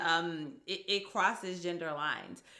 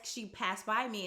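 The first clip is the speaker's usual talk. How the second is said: ordinary delivery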